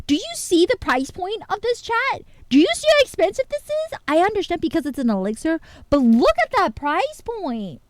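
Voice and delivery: high, loud exasperated rant at the price; incredulous rant. Real, not acted. Streamer complaining to chat about the price, exasperated. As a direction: protest to chat how absurd it is.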